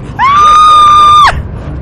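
very high, loud shriek; startled scream. Real, not acted. Gamer jumping at a scare, piercing shriek.

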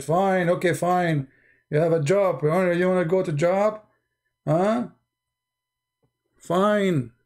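lightly put-on henpecked husband giving in; imitating meek husband. Mocking the husband who dares not refuse his wife, meekly. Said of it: "He don't dare even to say no".

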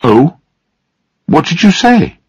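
high-pitched, curious query; surprised question. A narrator's reading. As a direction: ask in surprise, pitch jumping up. Daughter questions father's surprise news.

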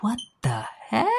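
a stunned what-the-heck at a weird scene; mock baffled gasp. Recapper reacting to the character eating hair, stunned. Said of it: "I'm like".

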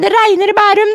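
"regner" stressed, high granny voice; first "det regner i Bærum" as a fussing high grandma; imitating grandmother. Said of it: "Mormor sier".

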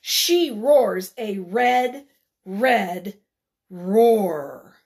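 dramatic story reading. A narrator's reading. Reads the roar dramatically.

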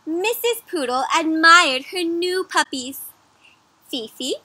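slow, bright read-aloud voice; story reading. A narrator's reading. Teacher reading the storybook to young children, read-aloud style.

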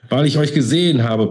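high-pitched outburst; upset, sudden high cry; bursting out. A narrator's reading. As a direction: blurt out the accusation. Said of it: "platzte es aus ihr heraus".

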